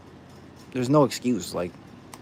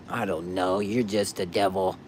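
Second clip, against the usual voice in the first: suspicious doubter voice; 4 semitones lower, slower than usual. Pretend. Voicing the doubter.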